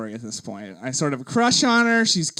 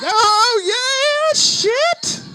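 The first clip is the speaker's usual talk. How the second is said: triumphant whoop; 14 semitones higher, 5 dB louder, much slower than usual. Retold or imitated. High-fives after the prank call.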